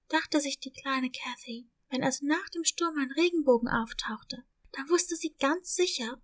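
usual speaking voice

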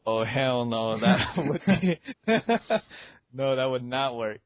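ordinary delivery

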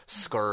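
"scared" said as "skurd"; slangy "scurred". Retold or imitated. Co-host quoting Michael Wilbon's word, "scared" said as "skurd". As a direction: give "scared" an "ur" vowel, like bird. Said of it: "That's a perfect word".

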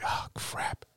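breathless whisper to himself; whispered dismay; whispered oh crap. Retold or imitated. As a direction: mutter it under your breath, caught out. Retold reaction to rejection.

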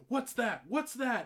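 loud, high, sharp retold demand; boss demanding. Boss pointing at unpainted pipe, loud demanding. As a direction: bark it like an irritated micromanager. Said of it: "he points at it and he's like".

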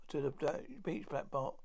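usual speaking voice

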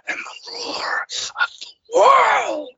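high, loud, breathy shouted proclamation; Psycho Sid impression. Cohost jokes about Sid's promo, high hammed-up shout. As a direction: howl it like a deranged wrestler. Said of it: "of Psycho Sid".

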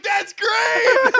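high, loud whoop of approval; over-the-top thrilled yell; excited shout. Retold or imitated. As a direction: shout it high in delighted amazement. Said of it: "I was like".

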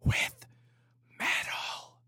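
hushed, breathy retake; whispered repeat. Acted. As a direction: whisper it back. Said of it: "compressor on this microphone".

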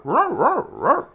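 high loud playful roar; mock roar. Kid roaring at a dog, loud. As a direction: roar like a silly monster.

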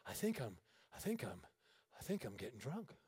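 low soft muttering; soft dazed muttering; quiet realization. Retold or imitated. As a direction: murmur it as it dawns on you. Realizing he is drunk.

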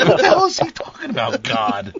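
laughing. Retold or imitated. Recalling camp confusion to friends, laughing. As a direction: laugh at the absurd misunderstanding.

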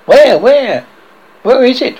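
high, loud, startled questions; alarmed. A narrator's reading. Husband starting up from sleep at his wife's cry, alarmed. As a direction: call out as if jolted awake in alarm. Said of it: "He started up in alarm".

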